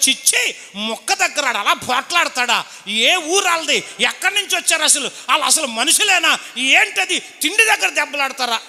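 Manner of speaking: mock outrage. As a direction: feign shock at the brawlers. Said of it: "అంటున్నాను నేను పైకి".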